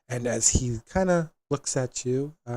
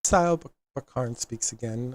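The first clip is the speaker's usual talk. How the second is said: normal talking voice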